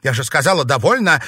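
loud, high, firm order to stop; exasperated protective cry. A narrator's reading. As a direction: call a halt sharply. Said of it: "прикрикнула Клариса".

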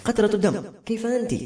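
high-pitched eager questions. A narrator's reading. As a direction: greet her eagerly, voice lifted high. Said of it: "قالت مرام بتلهف".

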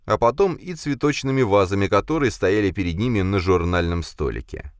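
ordinary delivery

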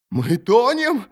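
high, frightened question. Acted. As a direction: ask in panic. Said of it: "спросил другой".

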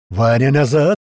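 loud warning order; shouting. Acted. Tuman shouts a new order to Vanya, loud yell. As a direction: shout across to a man. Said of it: "прокричал ему Туман".

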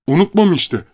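loud, high cry; at full voice. Acted. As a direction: shout it with all his voice. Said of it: "olanca sesiyle". (olanca sesiyle bağırarak)

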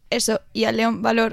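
ordinary delivery

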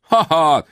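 loud, high fake laugh; a staged bandit's ha-ha; hammed-up laugh. A narrator's reading. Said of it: "Center laughs". (színlelt nevetés)

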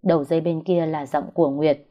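plain reading voice

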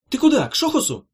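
high, loud quick question; a hint of eager Kornelij; lightly voiced young man.

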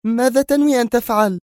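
high-pitched woman's startled question; astonished question. Acted. Mona asking Qadri what he plans, astonished. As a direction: call out the question in surprise. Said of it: "فهتفت تسأله في دهشة".

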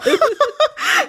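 high bursting laugh; hearty laugh. Acted. Teasing the neighbour about being younger, bursting laugh. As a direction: burst out laughing at your own tease.

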